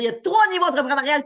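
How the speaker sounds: quoting her big reveal with a pumped-up voice; hammed-up excited announcer; mimicking the host's announcement. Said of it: "Elle va dire".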